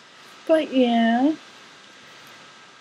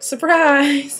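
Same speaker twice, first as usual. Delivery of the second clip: bright, much higher and louder cheer of surprise; mock surprise; 7 semitones higher, 10 dB louder than usual. Pretending to surprise her partner at work with a loud cheer. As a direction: pop out the word like a playful reveal. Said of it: "send it right when you went to work and be like".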